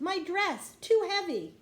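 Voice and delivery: high, loud, panicked; struggling. A narrator's reading. Annie calls to Jack from the water, panicked and high. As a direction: cry out while sinking.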